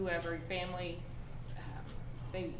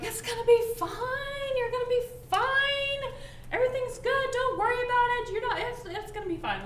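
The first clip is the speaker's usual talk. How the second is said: high cheery Joy voice; 14 semitones higher, 7 dB louder than usual. Retold or imitated. Joy brushing off a crying friend, chirpy high reassurance. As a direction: chirp it like a relentlessly upbeat cartoon. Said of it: "is like".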